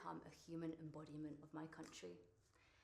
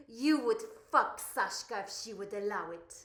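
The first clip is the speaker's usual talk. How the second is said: forceful, much louder than talk; loud acted outburst; loud exclamation; 13 dB louder than usual. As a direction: call it out at full volume. Confronting client in acted scene.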